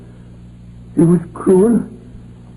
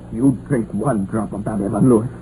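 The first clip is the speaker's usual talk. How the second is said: low, menacing threat; 7 semitones lower than usual. Acted. Miner threatening his mate over tea, low and menacing. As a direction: warn him off the tea, low and deadly.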